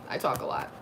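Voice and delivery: whispered "I talk a lot" under the breath; whispered aside. Real, not acted. To her viewers, a whispered confession that she talks a lot. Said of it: "I, I talk a lot".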